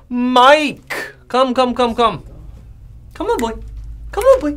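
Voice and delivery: high-pitched coaxing call to a pet; calling a dog. Pretend. Teacher calling Mike out to practice, dog-calling. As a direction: summon him like a puppy, voice way up.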